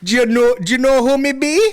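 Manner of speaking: high-pitched mock Jamaican patois; hammed-up Jamaican accent, way up high; squeaky party boast in Jamaican patois. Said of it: "He's at parties like".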